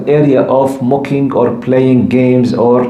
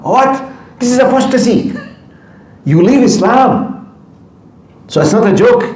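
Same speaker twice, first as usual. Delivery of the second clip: suddenly much higher, stunned and indignant; shocked outrage; 10 semitones higher than usual. Real, not acted. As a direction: react as if you can't believe what you heard. Outraged at youth cursing God.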